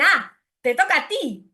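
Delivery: put-on mild child voice repeating the phrase; child's literal echo. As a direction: parrot it back as a toddler would. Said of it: "ellos dicen".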